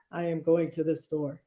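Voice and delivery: very low voice. Shown as a demonstration. Speaker showing the bottom of the vocal range, very low voice. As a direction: drop down to the bottom of your range. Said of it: "or make your voice very low".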